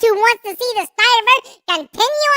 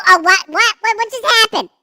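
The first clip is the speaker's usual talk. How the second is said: ordinary delivery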